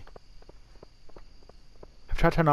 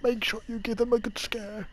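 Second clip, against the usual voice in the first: character voice; 9 semitones higher, 4 dB quieter than usual. Acted. Voicing a game character.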